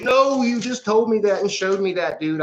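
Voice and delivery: exasperated protest. Retold or imitated. Telling Donnie he already said this, high exasperated protest. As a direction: protest hard, voice climbing high in disbelief. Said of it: "And I was like".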